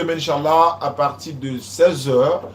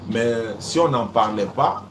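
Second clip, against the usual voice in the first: usual speaking voice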